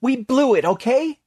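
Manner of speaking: high, loud, frantic admission; almost hysterical. A narrator's reading. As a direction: burst out near hysteria, very high. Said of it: "almost hysterical".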